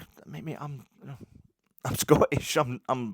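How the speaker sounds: pleading I'm Scottish, half laughing; flustered protest. Retold or imitated. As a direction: backpedal quickly, a bit panicked and amused. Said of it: "And I'm like".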